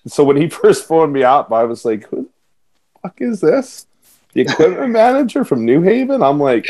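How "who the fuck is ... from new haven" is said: pitched-up mock disbelief at the caller; incredulous. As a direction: retell your stunned reaction to a stranger's call. Retold baffled first phone call.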